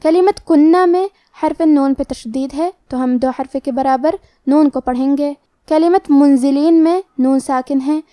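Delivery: ordinary talk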